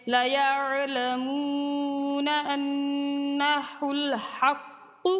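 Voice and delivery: slow, drawn-out melodic tilawat; slow chanted Quran recitation. Acted.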